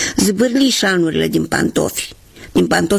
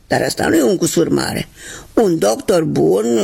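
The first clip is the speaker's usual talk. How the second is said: higher, put-on voice for a worn shoe; shoe character voice; 5 semitones higher than usual. Worn right shoe telling its twin, higher voice. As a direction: play the old shoe hoping to be given away.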